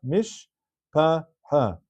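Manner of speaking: "Mishpacha" very slowly, mish, pa, cha with gaps; shva nach reading. Shown as a demonstration. Teacher explaining the shiva under the shin, slow syllable demonstration. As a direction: sound out "Mishpacha" syllable by syllable, sh left vowelless. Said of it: "the shiva under the shin is a shiva nach".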